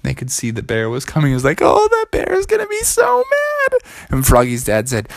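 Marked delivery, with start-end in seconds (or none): 1.6-3.8 very high, hammed-up alarm; panicked wail. Froggy sees the bear coming, panicked wail. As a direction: squeal it in mock panic.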